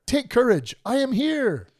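high, ringing call of encouragement; good father calling. Retold or imitated. Jesus as a good father to frightened disciples, high rallying call. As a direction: call out across the waves to hearten them. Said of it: "just a good father".

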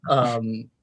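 ordinary delivery

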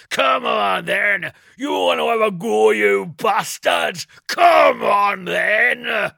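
loud, slow, challenging bellow; defiant yell. Acted. Bleacher daring the soldiers to fight, roaring. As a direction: taunt them at the top of your lungs. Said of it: "Bleacher yelling".

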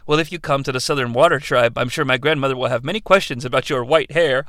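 lightly voiced coy princess; understated flirty reply; mock princess. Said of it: "share some pretty awful dialogue".

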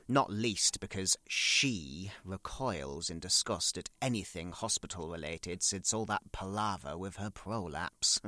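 ordinary talk, nothing marked

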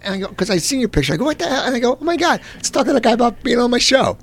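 high-pitched, mock-astonished reenactment; amazed retelling. Host retells spotting the guest's picture, amazed.

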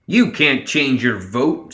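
mild shout of objection. A narrator's reading. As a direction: protest with drawn-out words. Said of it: "Summer shouted".

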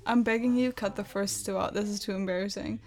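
ordinary talk

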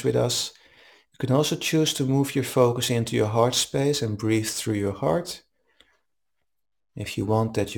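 calm, even meditation guide voice; guided meditation. Acted. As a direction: guide them gently into the breath. Leads a guided breathing meditation.

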